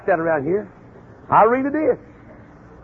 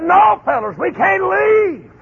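slow, higher, building to a shout; rallying cry; 9 semitones higher, slower than usual. Retold or imitated. Caleb urging the spies to take the land, building to a shout. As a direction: urge the men forward with rising force.

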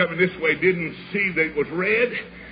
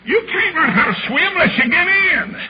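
high, raised parental voice; insistent father; 11 semitones higher, 3 dB louder than usual. Retold or imitated. Father urging his son into the cold pond, insistent high voice. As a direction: push the point in a high, carrying voice.

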